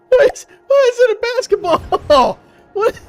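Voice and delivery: very high, squeaky laughing disbelief; giggling high-pitched bafflement. Real, not acted. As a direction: crack up while asking why. Laughing at basketball missile.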